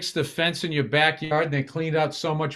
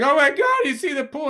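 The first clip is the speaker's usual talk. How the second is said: high, louder start of a retold exclamation; imitated alarm; 10 semitones higher, 4 dB louder than usual. Husband imitating wife seeing mud in the pool, alarmed.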